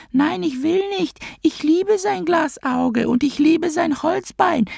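put-on extremely high, squeezed little girl falsetto; Hedda Goebbels, pressed child voice. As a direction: plead with mummy as a protesting eight-year-old, in falsetto. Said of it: "presste die Stimme noch eine Oktave höher". (gepresste, extrem hohe Kinderstimme, flehend)

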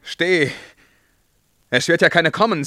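loud, high shout to halt; sudden command. Acted. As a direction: bark the order, then reassure yourself loudly.

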